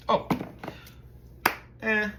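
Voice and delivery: ordinary delivery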